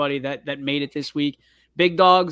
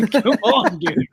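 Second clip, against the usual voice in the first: laughing; 4 semitones higher, 6 dB louder, slower than usual. Real, not acted. Laughing complaint about nickname.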